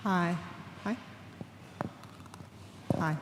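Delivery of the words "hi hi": usual speaking voice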